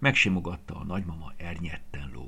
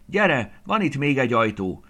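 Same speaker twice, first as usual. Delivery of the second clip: loud, high call down the hallway; shouting; 8 semitones higher, 9 dB louder than usual. A narrator's reading. Peti calling Andris from the hallway, loud excited shout. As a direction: call your friend over, excited. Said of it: "kiabált Peti a folyosóról".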